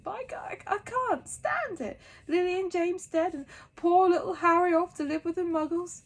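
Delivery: weeping big man breaking down; sobbing. A narrator's reading. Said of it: "sobbed Hagrid".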